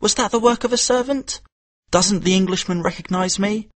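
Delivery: a hint of a hurt, angry boy; indignant thoughts. A narrator's reading. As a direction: Kim stung and offended.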